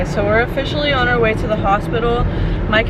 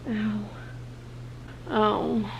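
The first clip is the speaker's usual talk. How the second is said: quiet ow of pain; pained groans; 12 dB quieter than usual. Real, not acted. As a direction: groan softly through pain. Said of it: "This hurts so bad".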